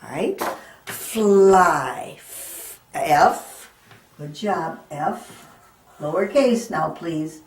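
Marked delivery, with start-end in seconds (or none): 0.8-2.2 fly said loud and drawn out; loud teacher model of fly; sounded-out fly. Shown as a demonstration. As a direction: stretch fly so the I is heard. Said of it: "the word fly".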